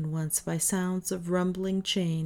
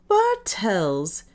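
louder, bursting with surprised delight; glad exclamation; 2 semitones higher, 5 dB louder than usual. A narrator's reading. Davis greets his old friend Bartels, loud and delighted. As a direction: call his old friend's name with joy. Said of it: "exclaimed Davis".

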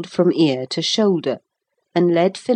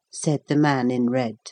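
ordinary delivery, nothing marked

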